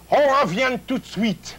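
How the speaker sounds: hammed-up French, pitched high; mock French. Echoing the chef's French in a playful French accent. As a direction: parrot the French like a showman.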